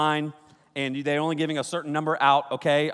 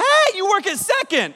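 much higher and louder, thrilled; excited recognition; 7 dB louder than usual. Retold or imitated. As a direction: call out like a starstruck fan. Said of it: "he turns around and goes".

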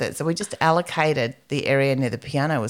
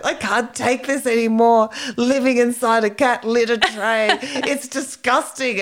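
mock tearful; 8 semitones higher, 4 dB louder than usual. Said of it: "She burst into tears".

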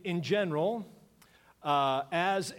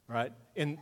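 ordinary delivery

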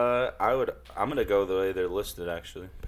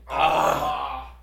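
ordinary delivery

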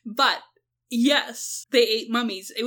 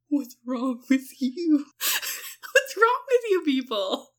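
exasperated; much slower than usual. Retold or imitated. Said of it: "And I'm like".

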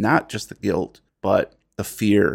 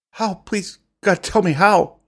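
desperate pleading; 12 semitones higher, 5 dB louder than usual. Acted. Character begs on the spot.

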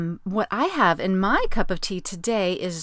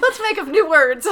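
laughing; 9 semitones higher, 9 dB louder than usual. Real, not acted. Both laughing at invented word.

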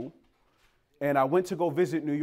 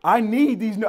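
eager; 6 semitones higher, 8 dB louder than usual. Retold or imitated. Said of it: "And I was like".

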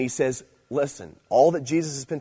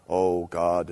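low, weighty church-prayer voice; mock-solemn "praying in church" voice; impersonal church prayer; 6 semitones lower, 4 dB louder than usual. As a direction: imitate a formal, impersonal prayer. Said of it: "almost impersonal relationship type of thing".

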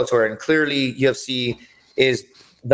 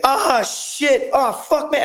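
very high strained mock panic; panicked cry; 13 semitones higher, much slower than usual. Opponent trapped in a crucifix, high panicked yelp. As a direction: yelp it like you're being crushed. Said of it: "he was like".

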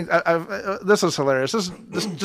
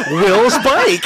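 laughing shout; 5 semitones higher, 6 dB louder than usual. Real, not acted.

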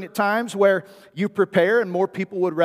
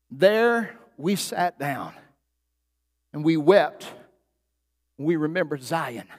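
scripture reading; 2 semitones lower than usual. Acted. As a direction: let each phrase of the psalm land in the pauses. Reads Psalm 137 slowly.

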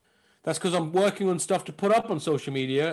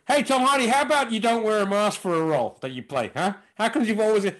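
higher, louder taunting call; mock heckling call; 6 semitones higher, 4 dB louder than usual. Crew mockingly calling out to Tom Hardy, raised teasing voice. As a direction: call out teasingly across the set. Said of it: "all the guys are gonna say".

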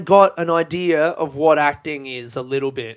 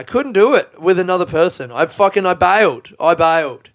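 loud confession; 3 semitones higher, 8 dB louder than usual. Real, not acted. Admitting he bailed.

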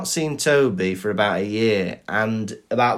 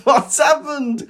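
high, slow mock shout of alarm; a friend yelping in fright on the phone; alarmed cry; 13 semitones higher, 4 dB louder, slower than usual. Friend on the phone hearing bad news, high alarmed cry. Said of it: "He goes".

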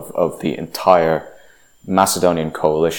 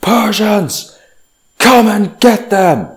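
much higher, slow, defiant battle cry; defiant yell; 14 semitones higher, 3 dB louder, slower than usual. Retold or imitated. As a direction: hurl the challenge back at the enemy. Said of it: "Leonidas yells back".

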